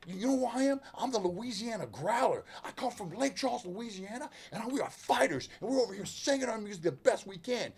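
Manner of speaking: a hint of yelling back at the rude radio host; retold angry yell. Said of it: "yelling at the guy".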